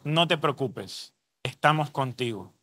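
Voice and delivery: low, soft, unhurried comfort; gentle reassurance. Retold or imitated. Elder comforting a young addict who begged for help, gentle reassurance. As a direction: quietly reassure someone in trouble.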